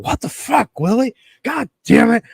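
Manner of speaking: high, squeaky mock outrage at Willie; mock exasperation. Friend quoted scolding Willie, squeaky hammed-up exasperation. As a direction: play the fed-up friend, voice shooting up. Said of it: "And Aaron's like".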